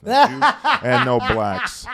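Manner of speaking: slow, loud, high announcer shout; imitating announcer, shouted. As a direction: boom it out like a venue announcer. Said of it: "He changed his voice a little".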